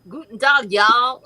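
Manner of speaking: Texan drawl with a German accent; German-flavored Texan accent. Shown as a demonstration. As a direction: greet like a German cowboy from Texas. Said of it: "So it's kinda like".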